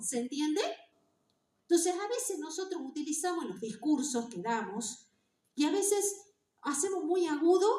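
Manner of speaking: ordinary delivery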